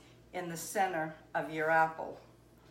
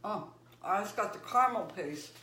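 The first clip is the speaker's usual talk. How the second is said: mouth full of hot food; 8 dB quieter than usual. Real, not acted. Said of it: "Hot, hot, hot".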